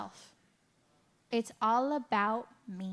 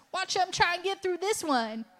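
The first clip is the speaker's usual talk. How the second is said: higher, teasing voice of a sneering God; mock mocking God; 6 semitones higher than usual. A speaker mocking the idea of a cruel God, teasing. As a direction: imitate a smug onlooker daring them. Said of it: "God's not sitting up there going like".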